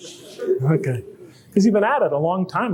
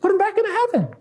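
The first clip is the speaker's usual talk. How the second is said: much higher, pleading indignation; indignant inner voice. Retold or imitated. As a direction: protest like a wronged child. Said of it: "I thought".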